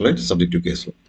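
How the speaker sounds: ordinary talk, nothing marked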